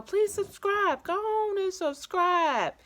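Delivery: high, slow coaxing sing-song; drawn-out high-pitched begging for subscribers; sing-song plea. Acted. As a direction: coax them to subscribe, stretched way up high.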